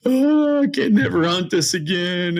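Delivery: hammed-up tearful lament, pitch leaping up; mock crying. Hunter reliving losing his favourite stand, mock crying. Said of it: "you're, like, crying tears".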